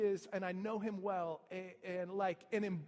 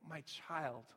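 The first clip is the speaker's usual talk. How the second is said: lower, softer tender call; deep empathy; 5 semitones lower, 5 dB quieter than usual. Shown as a demonstration. Father tenderly addressing his resentful son, soft and low. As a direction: speak it like holding a grieving son. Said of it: "like, with this deep empathy".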